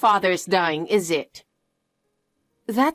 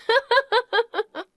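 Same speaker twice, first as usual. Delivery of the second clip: mocking laugh; 12 semitones higher than usual. Sister dismisses father's death.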